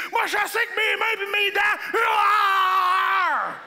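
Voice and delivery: high, loud boast building to a roar; macho hunter. Acted. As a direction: boast like a wild man, then roar.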